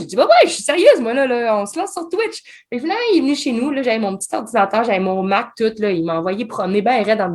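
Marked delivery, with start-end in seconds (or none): 0.2-2.4 a retold emphatic yes, pitched high; emphatic insistence. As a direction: insist she means it, pitched up.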